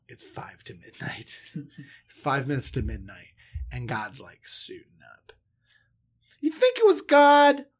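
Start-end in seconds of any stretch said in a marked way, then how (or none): 0.1-1.4 spooky whisper. Acted. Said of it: "whispered scene-setting". 4.2-5.3 spooky whisper. Acted. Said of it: "whispered scene-setting".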